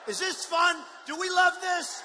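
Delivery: loud, high cheer to the crowd; shouting to the arena crowd; rally shout. Real, not acted. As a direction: fire up the crowd.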